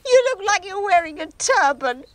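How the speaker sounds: high, loud laughing teasing; laughing while teasing. Acted. Mother laughs at her son's bandaged head, giggling. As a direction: giggle through the words at him.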